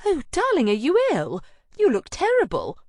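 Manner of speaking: high, loud alarmed friend voice; dramatic fussing over her friend; Anna horrified at how her friend looks; shocked friend. Acted.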